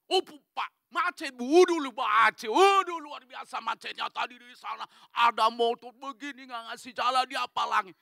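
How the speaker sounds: loud, high mock whining about traffic; blustering excuse. Driver explaining his lateness to the boss, blustering. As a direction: pour out exaggerated excuses at high pitch.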